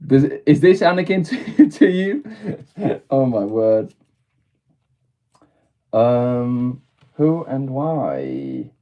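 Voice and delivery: usual speaking voice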